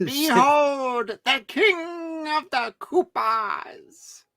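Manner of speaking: high, slow grand proclamation; Grand Vizier voice. Retold or imitated. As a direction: announce it like a pompous royal herald. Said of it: "this Grand Vizier voice".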